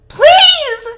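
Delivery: high shriek torn from the throat; harsh guttural shriek. A narrator's reading. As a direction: shriek it as a desperate plea. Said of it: "he shrieks".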